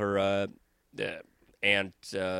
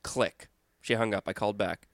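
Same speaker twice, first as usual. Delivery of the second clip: normal talking voice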